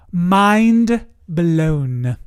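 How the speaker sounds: drawn-out, swelling MIND, then BLOWN falling away; dramatic awe. Real, not acted. Storyteller at the climax of the monk story, drawn-out awe. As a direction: stretch both words out for effect.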